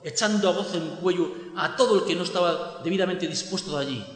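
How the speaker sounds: plain reading voice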